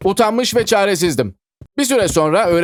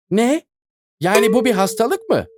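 higher, astonished question; surprised; 6 semitones higher than usual. Acted. Girl hearing it is an illness, surprised higher voice. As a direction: catch the news off guard. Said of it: "dedi şaşkınlıkla".

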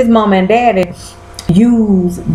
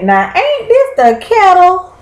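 high, mocking scold; sassy call-out; 4 semitones higher than usual. Real, not acted. To the viewers, scolding Fitz for his hypocrisy. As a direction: call him out with sass. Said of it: "I said".

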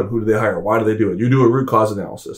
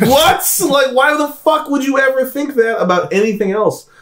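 incredulous outburst; 13 semitones higher than usual. Real, not acted. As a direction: explode in real disbelief. Incredulous outburst at baseball logic.

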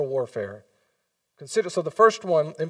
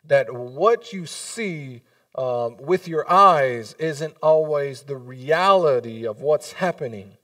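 slow emphatic Paul; 5 dB louder, slower than usual. Retold or imitated. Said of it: "and he goes".

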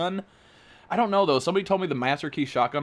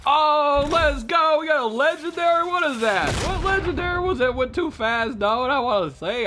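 much higher and louder, hyped; excited shout; 7 dB louder than usual. Real, not acted. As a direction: yell with joy at the drop. Huge legendary drop while opening.

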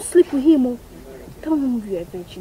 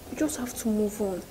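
hushed bad news; 11 dB quieter than usual. Friend softly whispering that she must move on. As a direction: whisper the hard truth gently.

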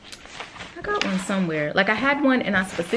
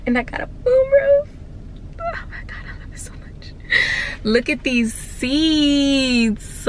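much higher, tearful then breathless; overjoyed gush; 6 semitones higher than usual. Real, not acted. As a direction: gasp in delight at the moonroof. Overwhelmed by moonroof.

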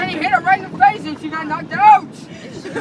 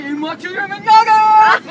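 loud taunt; 3 semitones higher, 7 dB louder, slower than usual. Retold or imitated. [name] taunting the victim.

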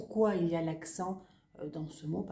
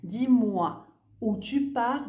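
slow example; 3 semitones higher, 5 dB louder than usual. Shown as a demonstration. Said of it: "Par exemple".

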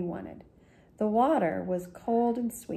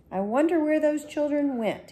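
higher, louder grumbling baker; lightly voiced baker, higher; baker's voice; 8 semitones higher, 4 dB louder than usual. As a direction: pitch it up as the baker wondering aloud. The baker speaks.

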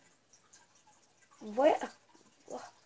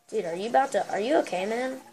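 ordinary talk

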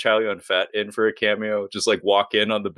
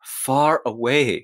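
very slow, deliberate deadpan delivery; deadpan movie line; much slower than usual. Retold or imitated. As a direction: draw it out with mock gravity. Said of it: "looks you dead in the eyes and says".

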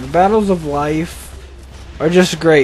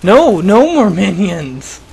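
dismayed outburst; 4 dB louder, slower than usual. Real, not acted. Protesting as more minions appear.

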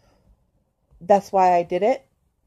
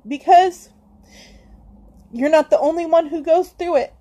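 higher and louder, sobbing through the words, slowing at the end; crying; 5 semitones higher, 4 dB louder than usual. Real, not acted. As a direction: reach out to viewers while crying. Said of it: "Why is she crying at me".

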